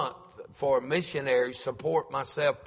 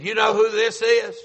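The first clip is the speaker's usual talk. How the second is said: caller's voice; 8 semitones higher, 8 dB louder than usual. Retold or imitated. Caller voiced in retold call.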